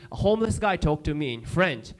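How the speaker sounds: ordinary talk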